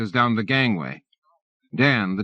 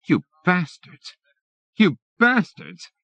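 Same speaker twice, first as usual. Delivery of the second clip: higher, slow shaking fury; furious; 9 semitones higher than usual. A narrator's reading. Jill raging at Bin and the team, furious. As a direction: spit it out trembling with rage. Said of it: "shaking with fury".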